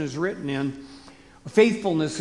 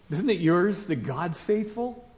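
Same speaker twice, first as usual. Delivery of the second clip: usual speaking voice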